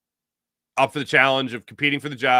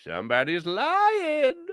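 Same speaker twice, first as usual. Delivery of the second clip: high, slow, drawn-out singsong; sing-song accusation; 13 semitones higher, much slower than usual. Pretend. Host teasing the team over who lied, slow high singsong. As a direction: tease it out like a playground taunt. Calling out a liar.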